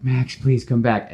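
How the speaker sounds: mock pleading call. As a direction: beg him to come back. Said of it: "The poor woman's like".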